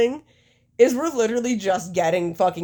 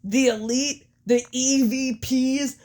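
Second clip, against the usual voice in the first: slightly raised, declaiming promo voice; Matt Jackson; 4 semitones higher than usual. Acted. Wrestler offers peace to rivals, terrible Matt Jackson impersonation. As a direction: deliver a hammed-up wrestling speech, phrase by phrase. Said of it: "It's a terrible fucking Matt Jackson impersonation".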